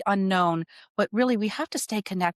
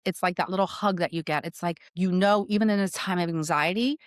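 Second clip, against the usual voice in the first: ordinary delivery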